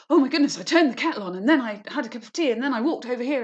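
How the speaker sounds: teacher mocking an irritatingly overexcited person; overexcited chatterer. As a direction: bubble over about nothing at all. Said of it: "someone who's completely overexcited about everything".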